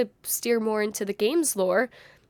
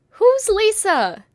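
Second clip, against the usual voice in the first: loud, high, drawn-out cry; dramatic question; 10 semitones higher, 9 dB louder, much slower than usual. Acted. Announcing the first big question to viewers, dramatic shout. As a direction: call it out slowly, high and loud.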